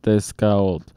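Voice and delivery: usual speaking voice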